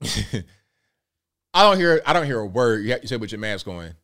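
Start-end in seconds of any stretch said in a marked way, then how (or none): 0.0-2.0 laughing. Real, not acted.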